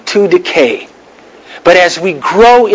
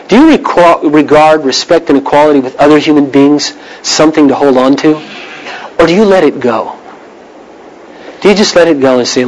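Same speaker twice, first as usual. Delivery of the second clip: normal talking voice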